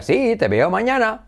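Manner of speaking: "Sí, te veo mañana" high and lilting; English-style tuneful "Sí, te veo mañana"; sing-song intonation. Shown as a demonstration. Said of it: "son como muy cantarines".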